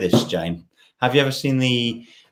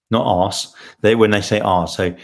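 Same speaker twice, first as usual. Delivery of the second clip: ordinary talk, nothing marked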